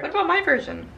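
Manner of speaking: high, loud eager question; fishing for a compliment. Real, not acted. Eager bid for praise.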